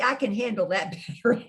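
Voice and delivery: ordinary talk, nothing marked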